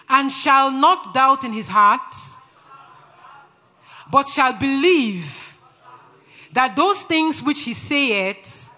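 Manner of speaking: slow, loud, emphatic call-out; authoritative scripture declaration. Shown as a demonstration. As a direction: declare it line by line with power. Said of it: "Say it with authority".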